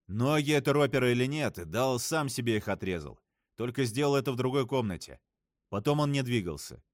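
plain reading voice

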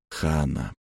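hushed, sinking name; quiet and low. A narrator's reading. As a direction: let the name fall away softly. Wistful memory of lost love.

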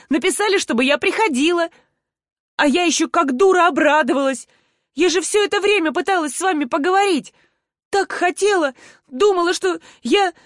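high-pitched aggrieved pleading; hurt, near tears. A narrator's reading. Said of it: "от обиды стало жечь глаза и выступили слёзы". (с обидой, на грани слёз)

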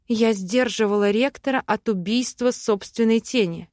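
ordinary delivery, nothing marked

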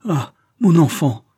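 s'écrie, plus haut et fort, lentement; un cri de père bouleversé, ralenti; cri ému. A narrator's reading. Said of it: "s'écria-t-il". (le père retrouve sa fille et s'écrie, ému)

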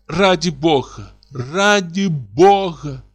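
hysterical plea. Acted. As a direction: beg in hysterics, voice stretched thin.

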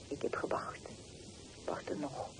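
breathy whispered confession. Acted. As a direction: whisper it as a secret.

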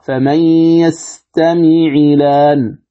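slow chant of "يستمع الآن" as yastami'i-laan; level tajweed chant, "الآن" without hamza after a voweled ع; kasra on 'ayn. Shown as a demonstration. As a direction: recite slowly with a short i on the ع of "يستمع", then long laan. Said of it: "تكسر العين التقاء الساكنين".

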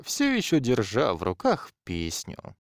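plain reading voice

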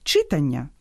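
«читання» stressed on the first syllable, ЧИ; wrong stress. Shown as a demonstration. Host mimicking pupils' error, wrong first-syllable stress. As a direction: hit ЧИ in «читання». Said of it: "перетворюється на урок якогось".

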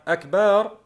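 أكبار with a long "baar"; added alif after ba. Shown as a demonstration. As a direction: stretch the vowel after the b in أكبار. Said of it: "وضع ألف بعد الباء". (أكبار بمدّ الألف بعد الباء)

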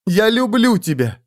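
high passionate outcry; passionate cry. Acted. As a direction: cry it out with desire. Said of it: "страстно закричала она".

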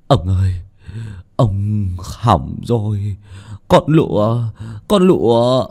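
giọng run, hoảng hốt báo tin; gấp gáp như sắp khóc. Acted. (người hầu hoảng hốt báo tin ông chủ)

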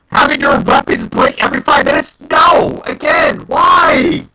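real despair shouted out; frustrated cry of why; anguished outcry. Real, not acted. Ranting about weapons breaking.

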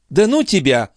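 high-pitched brush-off; dismissive retort. Acted. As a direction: wave away the teasing. Chon brushes off teasing.

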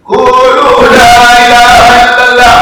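preacher shouting the call at full force. Acted. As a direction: cry it out to a whole crowd. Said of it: "I started to raise my voice".

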